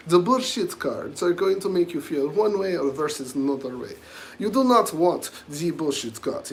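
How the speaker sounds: loud mock Russian mafia accent; lightly done Russian gangster accent, loud. Streamer playing a Russian mafia type to viewers, loud mock Russian accent. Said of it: "look like some Russian mafia".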